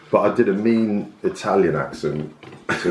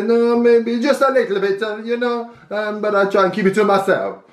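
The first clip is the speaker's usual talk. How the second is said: fake Italian; 11 semitones higher than usual. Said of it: "I'd pretend I was Italian".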